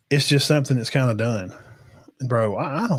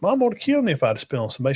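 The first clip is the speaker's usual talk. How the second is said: ordinary delivery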